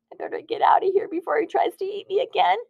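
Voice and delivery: high, fast, frightened rush; a scared donut thinking of escape. A narrator's reading. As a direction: blurt it out in a panic. Frightened Arnie plans escape.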